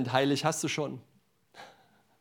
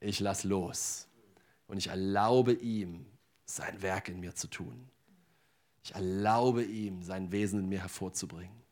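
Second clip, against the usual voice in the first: calm declaration of faith; 4 semitones lower, 4 dB quieter than usual. Acted. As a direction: let each line settle in peace. Quiet, slow faith declarations.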